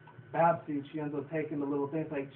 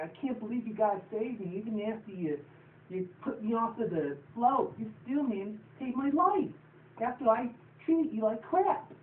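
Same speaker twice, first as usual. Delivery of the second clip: much higher, slow, welling-up thanks; teary grateful filly; 7 semitones higher than usual. Retold or imitated. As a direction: thank them like a choked-up cartoon filly. Babsy thanking her rescuers.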